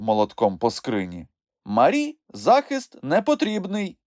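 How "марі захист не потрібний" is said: high, firm judge's pronouncement; raised-pitch, insistent ruling; emphatic ruling. A narrator's reading. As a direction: state it with emphasis from the bench. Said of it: "наголосив він".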